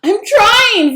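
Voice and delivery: high, loud frantic cry; cried out. A narrator's reading. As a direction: cry it out in a panic. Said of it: "Vera cried".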